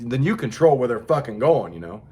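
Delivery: normal talking voice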